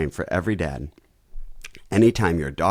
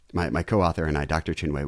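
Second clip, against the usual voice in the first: normal talking voice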